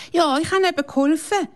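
put-on high, proud little girl. A narrator's reading. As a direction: show off that you helped. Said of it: "sagt Laura stolz".

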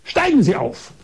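high-pitched bossy order; imitated imperious command. Woman doctor ordering patient into her house, imperious. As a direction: order them up like a haughty doctor. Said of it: "Da sagt sie ganz herrisch".

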